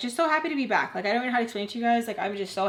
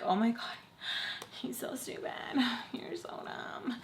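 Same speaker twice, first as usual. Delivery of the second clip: soft voiceless muttering to yourself; embarrassed whisper; 10 dB quieter than usual. Retold or imitated. Blushing after he walks off, embarrassed whisper. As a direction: drop into a whispered, sighing self-aside.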